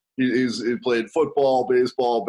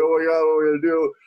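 panicked; 3 semitones higher, much faster than usual. Retold or imitated. Father stuck on the hook, panicking fast. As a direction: gabble in alarm, hook in his eye. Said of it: "And he's like".

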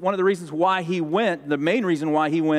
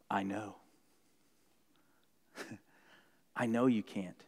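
gentle voice of God; 8 semitones lower, 13 dB quieter than usual. Acted.

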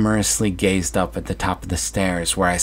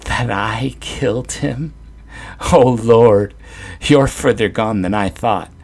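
chuckling; 4 semitones higher, 5 dB louder than usual. Acted. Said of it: "between bouts of compulsive chuckling".

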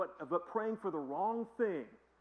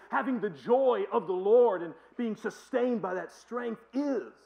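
preaching at full force; 5 semitones higher, 11 dB louder, slower than usual. Real, not acted. Preaching climax.

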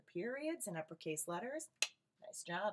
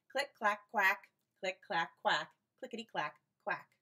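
duck typing; 8 dB louder than usual. A narrator's reading. Ducks typing sound.

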